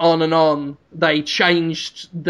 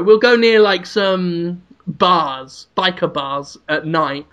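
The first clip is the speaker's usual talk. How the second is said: ordinary delivery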